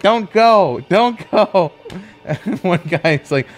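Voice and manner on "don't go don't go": high imitated calls to stay; pleading crowd. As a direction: beg him in a raised, urgent pitch. Residents beg him not to leave.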